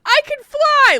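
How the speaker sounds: high, loud victorious cry; triumphant shout. Retold or imitated. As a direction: shout it like you just won. Triumph after finishing grind.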